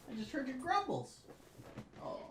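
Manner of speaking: high, soft cooing voice. Real, not acted. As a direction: murmur it sweetly to the dog. Talking to his dog.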